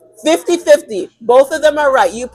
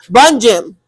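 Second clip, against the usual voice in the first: dismissive brush-off; 3 dB louder than usual. Retold or imitated. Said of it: "you're saying to yourself".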